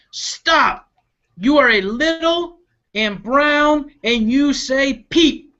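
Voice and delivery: high, slow scolding crow voice; mock-stern squawk; bossy crow. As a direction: stop her like an annoyed crow. Crow scolds little bird.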